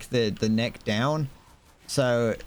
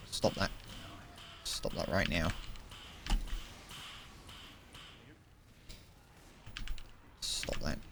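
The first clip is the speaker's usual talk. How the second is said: much softer, low muttering at the screen; muttered to self; 5 semitones lower, 15 dB quieter than usual. Real, not acted. Watching an enemy player, a soft low mutter. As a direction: grumble it under your breath.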